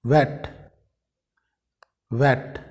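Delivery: word वेट said with an English V; clear V sound. Shown as a demonstration. Teacher showing learners the V sound, clear V. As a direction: say वेट crisply, starting on V. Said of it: "इसको हम बोलेंगे".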